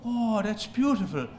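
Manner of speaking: punchline in a put-on Irish accent. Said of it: "in his little Irish accent".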